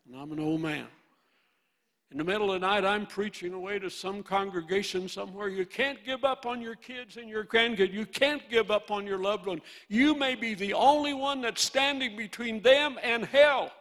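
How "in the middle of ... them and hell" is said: dream preaching. Retold or imitated. Old preacher retelling a dream sermon to his congregation, building force. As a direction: preach it out like at the pulpit.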